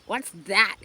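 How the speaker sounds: a brother demanding to know what Fern got. Acted. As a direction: insist on an answer. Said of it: "he demanded".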